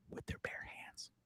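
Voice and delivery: very soft, slowed-down whisper; hushed whisper. Real, not acted. Host warns viewers about two deadly guests, hushed whisper. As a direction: drop to an awed whisper.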